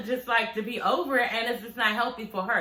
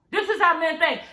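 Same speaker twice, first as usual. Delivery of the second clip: ordinary talk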